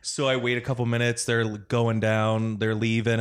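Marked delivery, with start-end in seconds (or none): none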